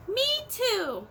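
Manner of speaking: high, bright little squeak; small child kangaroo piping up; young kangaroo. Acted. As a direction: chirp it like a tiny joey. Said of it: "the young kangaroo in her pouch said".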